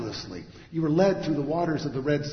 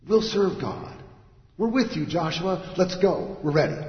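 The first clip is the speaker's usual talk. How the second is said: louder, higher, rallying cries; eager response; 5 semitones higher, 6 dB louder than usual. Acted. The people answering Joshua, raised and eager. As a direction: answer as an eager crowd ready to go. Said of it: "the people's response".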